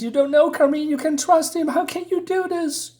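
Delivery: high-pitched, acted distress; tearful pleading. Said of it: "And she's crying".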